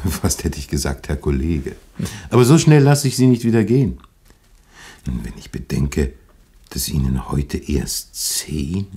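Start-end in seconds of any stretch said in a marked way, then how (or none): 2.3-4.0 louder, higher and quick; eager. Acted. Pastor refusing to let the rabbi leave, eager and loud. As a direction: rush it out, keen to hold him there.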